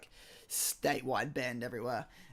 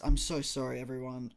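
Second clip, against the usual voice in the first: ordinary talk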